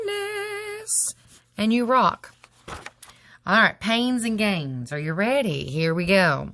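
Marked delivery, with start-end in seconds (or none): 0.0-1.1 very high, soft, sing-song bonus; squeaky bonus. Acted. Teacher to students who did extra, a high squeaky bonus. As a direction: pipe up high like a cheeky reward. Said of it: "I'm gonna go there".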